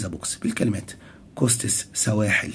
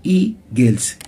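"eagles" with a louder first syllable, EA-gles; stress on first syllable; 4 dB louder than usual. Shown as a demonstration. Teacher showing where stress falls, first syllable stressed. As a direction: hit the EA of "eagles". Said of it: "عندها شدة on the first syllable".

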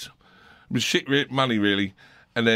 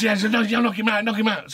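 egging on; 10 semitones higher than usual. Retold or imitated. Ian Wright urging the punch.

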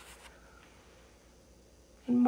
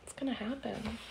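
plain reading voice

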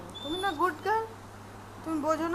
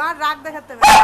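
loud giving in; 16 dB louder than usual. Real, not acted. Calming child, giving in.

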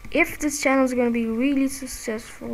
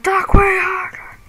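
raised, high and loud demand to speak up; yelling up at the gamer, louder; mock yell from a teammate; 7 semitones higher, 5 dB louder than usual. Player voicing a teammate's complaint, a mock high yell. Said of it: "I'm like".

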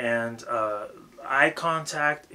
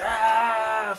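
loud, high "ah" mimicking rapper antics; mock stage yell; 8 semitones higher, 4 dB louder than usual. Coach mocking a rapper's antics on stage, mock yell. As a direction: imitate an overexcited rapper's wordless yell. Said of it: "a rapper on stage doing all kinds of".

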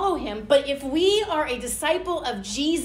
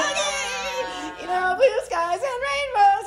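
mock cheerful; 10 semitones higher, slower than usual. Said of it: "You're like".

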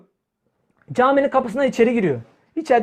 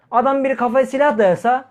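ordinary talk

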